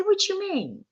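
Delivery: surprised. Retold or imitated. Hearing she's hooked on dope, a startled high question. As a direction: ask it in sudden disbelief. Learning she is dope sick.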